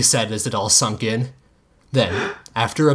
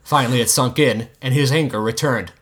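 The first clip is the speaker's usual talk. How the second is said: ordinary delivery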